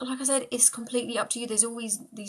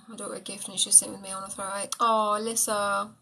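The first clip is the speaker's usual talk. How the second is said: normal talking voice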